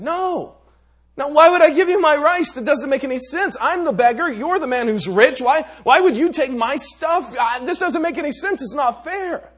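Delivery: loud, high furious outburst; angry beggar. Acted. Beggar refuses the rich Raja, loud and furious. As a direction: shout it's not fair at him. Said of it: "now the man was angry".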